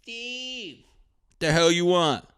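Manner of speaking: high shouted "Steve!" up the stairs; wife calling from upstairs. Retold or imitated. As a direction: holler for husband in a high mock wife voice. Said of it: "His wife's still into T V, like".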